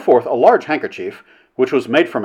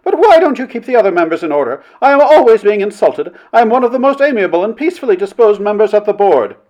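high, indignant complaint; affronted committee matron; aggrieved Mrs. Blank; 9 semitones higher, 5 dB louder than usual. A narrator's reading. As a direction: protest loudly as the injured party.

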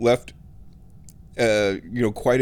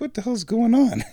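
high, puzzled mock outburst; retelling confusion while driving past the set; surprised; 12 semitones higher than usual. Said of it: "And I was like".

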